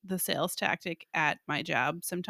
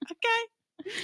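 high, pointed okay; high emphatic okay; 13 semitones higher than usual. Real, not acted. Emphatic punchline.

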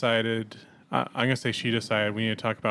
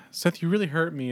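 higher-pitched wounded imitation; mock hurt; 6 semitones higher than usual. Imitating a friend who cries over a joke, mock hurt. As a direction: plead with a little put-on hurt. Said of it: "he cries about it".

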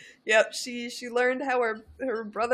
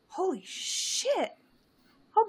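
stunned; 9 semitones higher, slower than usual. Real, not acted. Shocked aside.

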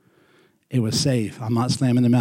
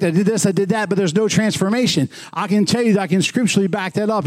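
higher, impassioned pulpit delivery; full-force preaching; 5 semitones higher than usual. Acted. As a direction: preach it to the congregation. Pentecost preaching builds.

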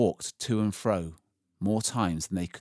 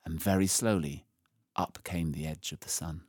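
plain reading voice

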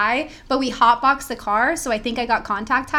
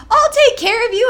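much higher and louder, a hammed-up drunk buddy; drunk friend; 13 semitones higher, 9 dB louder than usual. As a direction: play the sloppy drunk who promises to look after her. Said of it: "he was super drunk".

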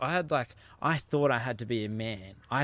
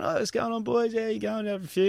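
much higher, bright matey surfer greeting; pretending to be a local; 10 semitones higher than usual. Surfer to the lineup, pretending to know everyone, in a high chummy call. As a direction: greet strangers like old mates to blend in. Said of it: "started pretending like I knew everyone".

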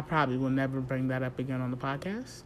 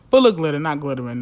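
loud correction; 10 dB louder, faster than usual. Real, not acted. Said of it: "That's not a word".

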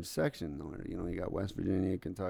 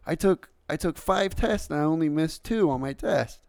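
put-on higher, louder child's announcement; imitated daughter; 6 semitones higher, 5 dB louder than usual. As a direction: play the daughter proudly reporting to Dad. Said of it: "Here comes my daughter. And she's like".

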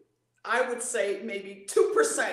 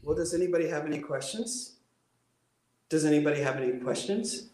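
dull unwarmed speaker voice; 5 semitones lower, faster than usual. Shown as a demonstration. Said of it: "you've heard that voice".

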